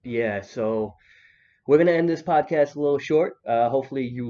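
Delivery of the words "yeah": ordinary talk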